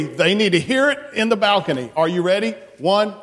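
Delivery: normal talking voice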